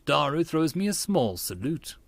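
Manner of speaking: ordinary delivery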